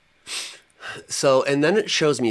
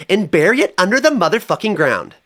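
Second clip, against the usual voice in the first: put-on high and loud, sugary baby-talk voice; high cutesy spirit voice; 10 semitones higher, 6 dB louder than usual. Spirit cooing at him about the coffin, high and loud. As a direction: coo at him like a cute pet, then turn it crude.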